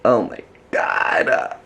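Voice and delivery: awed. Real, not acted. Amazed at plant.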